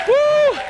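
a high, loud preacher's whoop; exultant woo. Real, not acted. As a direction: whoop for joy at the height of the sermon.

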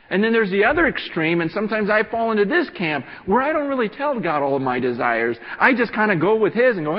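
loud, high, urgent preaching; full-force preaching. Acted. Pastor urging the church to pray, preaching loud and high. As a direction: preach it hard to the congregation.

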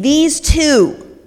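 loud, slow announcement; hammed-up shouting possessed woman; screaming woman. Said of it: "the woman starts screaming".

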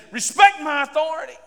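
a mock stomp-your-foot order; foot-stomping demand. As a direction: bark it like a petty tyrant. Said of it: "stomp my foot and say".

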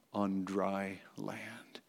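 whispered in awe, slow; hushed whisper. Acted. Preacher hushes the congregation in awe, whispering. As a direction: breathe it out like a secret. God parts the sea, awed hush.

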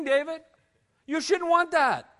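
usual speaking voice

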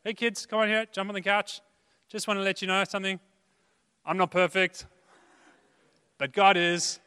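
upbeat dad. Retold or imitated. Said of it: "I know I can go there straight away".

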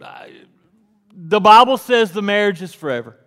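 loud, fast, high preaching about forever; preacher telling the congregation marriage is forever, fast and loud; full-force preaching. Acted. Preacher to congregation, booming and rapid.

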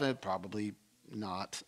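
quiet, deflated inner voice; meek aside. Retold or imitated. Thinking to himself after the threat, sheepishly quiet. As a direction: back down under your breath. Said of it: "I'm thinking to myself".

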